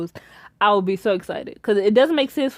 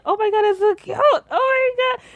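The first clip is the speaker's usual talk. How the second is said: gushing; 12 semitones higher than usual. Retold or imitated. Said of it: "I'm like".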